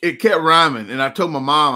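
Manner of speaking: normal talking voice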